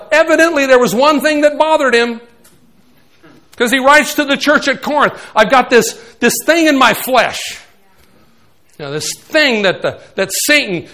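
loud, high preaching at full force. Acted. Pastor preaching to the congregation, at full force.

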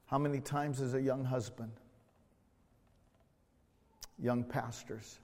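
soft, low voice with long pauses; quiet, solemn pulpit reflection; hushed reflective preaching. Acted. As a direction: let each phrase settle gently in the quiet.

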